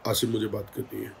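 soft, low, composed ask; calm quiet request. Retold or imitated. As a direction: ask him calmly, almost under the breath. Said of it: "जिस लहजे में, जिस level में, जिस tone में बात हुई". (शांत धीमी आवाज़ में)

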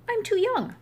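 high little calf voice refusing to push; young calf voice. Acted. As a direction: squeak it out like a small young calf.

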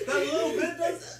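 slow laughing disbelief. Real, not acted. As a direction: laugh it out slowly. Laughing at pepper heat.

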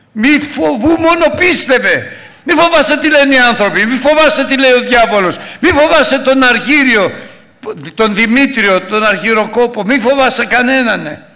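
high, loud shouted sermon; preaching at full force. Acted. Pastor shouting God's promise to the congregation, high and loud. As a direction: thunder it out to the church.

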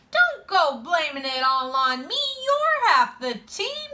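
loud, high-pitched comeback; indignant protest. A narrator's reading. Boy answering friend who blamed him, loud and indignant. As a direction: throw the blame back loudly.

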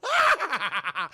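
loud gleeful yell; a hammed-up shout of glee; mock shout. Claw lifted, shouting.

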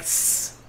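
unvoiced hiss sound; soft snake hiss. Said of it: "it's a snake".

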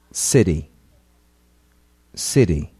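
City with the T as a quick D; flapped T. Shown as a demonstration. Teacher models City twice, flapped T. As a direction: say City like siddy. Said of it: "Ouça e repita".

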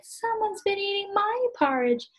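high little bear voice, upset; tiny cub whining about his empty porridge bowl; Baby Bear. Acted. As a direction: squeak it out like the smallest bear cub. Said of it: "Baby Bear said".

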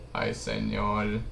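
ordinary talk

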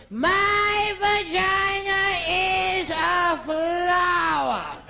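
hammed-up slurred Bette Davis; Bette Davis after a stroke. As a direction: drag each word like a stricken diva. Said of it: "like Betty Davis after the stroke".

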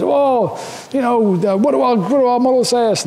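movie character quote. Retold or imitated. Retelling a movie scene to the audience, high mock-panicked voice. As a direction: ham up the desperate boss asking the models. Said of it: "And so he says".

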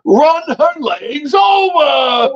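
slow, stretched, high mock shout; hammed-up punchline yell; retold enraged yell. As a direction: imitate a man bellowing an order, each word drawn out. Enraged order to drive, punchline.

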